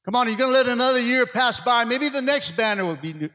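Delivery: loud, fast, high preacher's call; fiery preaching. Acted. Pastor urging the congregation, loud fast preaching. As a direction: rouse the church to act now.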